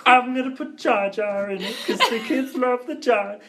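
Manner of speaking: high, loud mock director voice; George Lucas. Podcaster playing the director pitching a character, high mock voice. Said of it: "That's my George Lucas impression".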